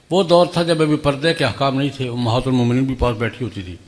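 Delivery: normal talking voice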